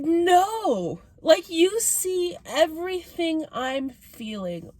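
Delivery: high, drawn-out protest; retold dismay. Seeing her crying face on camera, high drawn-out dismay. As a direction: pitch it up and stretch out the dismay.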